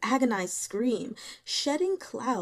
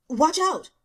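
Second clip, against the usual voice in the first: louder, sharp call to warn; urgent warning shout; 8 semitones higher, 9 dB louder than usual. A narrator's reading. Shen Wei warning Zhao Yunlan of the charging ghost, shouting. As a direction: yell it to stop the attack.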